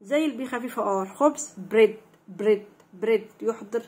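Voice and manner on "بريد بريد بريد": "بريد" with b and r run straight together; no gap between b and r in "بريد"; quick BR blend. Shown as a demonstration. Teacher drilling blended letters, "بريد" with joined BR. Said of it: "لازم أنطق الحرفين كده ورا بعض بسرعة".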